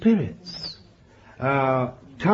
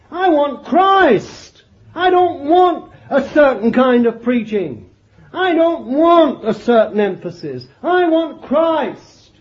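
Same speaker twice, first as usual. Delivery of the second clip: fervent sermon peak; impassioned preaching; 3 semitones higher, 4 dB louder than usual. Acted. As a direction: declare it with pulpit fire.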